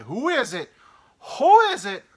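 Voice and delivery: high loud yelling, building; furious driver. Retold or imitated. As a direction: demand an answer at full volume. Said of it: "And he's like".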